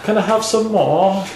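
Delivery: put-on high-pitched British child accent; Oliver. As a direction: beg in a little British orphan voice. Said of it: "like, Oliver".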